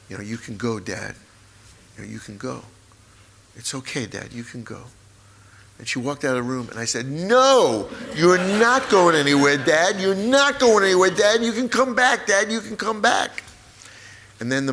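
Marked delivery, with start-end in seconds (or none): 0.2-4.8 soft, hushed reassurance; low-key, soft goodbye; gentle permission. Retold or imitated. Sister's retold goodbye to dying father. 7.1-13.4 loud, high desperate cry to a dying dad; wailing, insistent plea at full voice; anguished shout. Retold or imitated. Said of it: "And I yelled".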